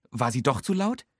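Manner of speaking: ordinary delivery, nothing marked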